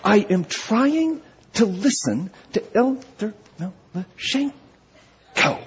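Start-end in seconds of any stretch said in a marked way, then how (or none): 0.0-4.5 tight, higher voice with a hiss of venom; put-upon scold, softer but biting; venomous. Retold or imitated. Annoyed woman scolding talkers during a sermon, venomous. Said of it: "with venom in her voice said".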